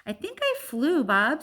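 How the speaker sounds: excited little gorilla; young gorilla. Acted. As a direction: beam like an excited little one.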